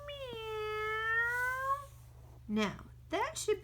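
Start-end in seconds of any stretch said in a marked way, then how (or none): 0.1-1.9 high, drawn-out meow; long meow. Acted. Cat's final meow.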